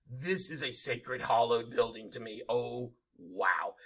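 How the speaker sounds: awed. Real, not acted. Awed by Independence Hall.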